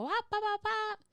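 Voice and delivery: high-pitched wop bop bop squeals; mock flustered reaction. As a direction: squeal like someone losing their cool. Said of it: "react and go".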